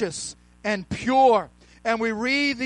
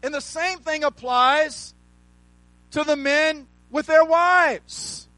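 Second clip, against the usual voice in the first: raised, ringing preacher's voice, shouting the key words; full preaching; 3 semitones higher than usual. Acted. Pastor preaching to his church, at full force. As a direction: preach it hard to the congregation.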